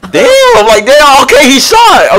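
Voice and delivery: shocked gasp. Real, not acted. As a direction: gasp then a high startled damn. Sudden shock in gameplay.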